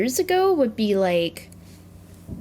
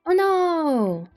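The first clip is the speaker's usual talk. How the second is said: surprised; 8 semitones higher than usual. Real, not acted. Reacts to game setback.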